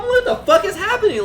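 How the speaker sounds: loud, high-pitched startled yell; startled outburst. Retold or imitated. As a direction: jump up in alarm, voice shooting high. Jumps up at banging on door.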